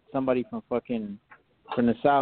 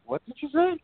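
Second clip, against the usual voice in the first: much higher, mock baffled question; confused what; 14 semitones higher than usual. Confused by an Australian dialect, a high baffled question. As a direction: squeak it out like you can't follow a word. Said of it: "and I'm like".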